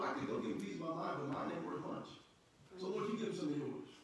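ordinary talk

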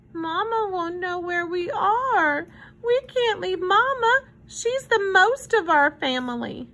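put-on high, plaintive child's voice; little girl. A narrator's reading. Girl refuses to leave Mama, pleading. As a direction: protest in a small, pleading, high voice. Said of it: "asked Susie".

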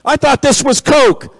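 hammed-up betrayed shopper; indignant customer. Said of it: "the people would get angry".

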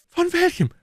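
very high, cheered-up landlord; delighted. A narrator's reading. Innkeeper cheered by the order for wine, delighted and high. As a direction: ask in gleeful high pitch. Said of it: "fragte der Wirt ganz erheitert".

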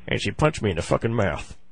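normal talking voice

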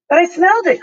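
put-on high, loud insistent cry from a boy; eager cry. A narrator's reading. Boy insisting to the professor, high excited cry. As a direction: push it up high and loud, he really did smell it. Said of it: "cried Tom".

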